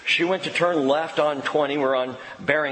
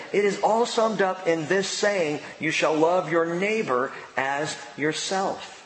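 scripture reading; 4 semitones higher than usual. Acted. Pastor reading Romans to the church, measured and solemn. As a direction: read the verses out to the congregation.

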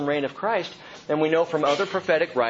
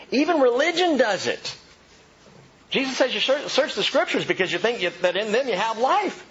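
excited; 11 semitones higher than usual. Real, not acted. Excited preaching build.

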